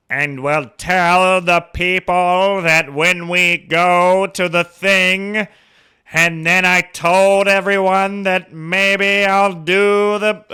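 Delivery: loud, high, drawn-out transatlantic-Southern oratory; hammed-up transatlantic drawl with a Southern twang; exaggerated old transatlantic and Southern accent, very slow and loud. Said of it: "transatlantic and southern accent".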